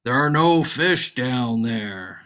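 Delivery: slow, loud voice rising from the hole; eerie voice from under the ice; mysterious voice. Acted. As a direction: boom it out slowly like an unseen voice.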